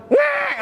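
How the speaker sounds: sharp, very high loud "ngek" jolt; startled yelp. Pretend. Tired mother jolted from sleep: sharp yelp. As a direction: yelp like a sudden shock. Jolted awake after one hour.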